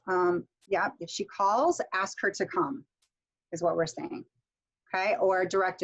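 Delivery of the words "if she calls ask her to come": "calls" rises, then "come" falls; rising introductory clause. Shown as a demonstration. Teacher modelling an introductory sentence, rising intonation on the first clause. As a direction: lift "calls", settle down on "come". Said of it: "we do it with introductory sentences".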